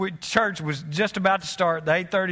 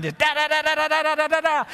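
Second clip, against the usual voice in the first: rapid high staccato da da da imitating sneezes; machine gun sneezes; 8 semitones higher, faster than usual. Pastor mimics Carolyn's sneezing fit, high rapid staccato. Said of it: "sneezed twenty times right in a row like a machine gun".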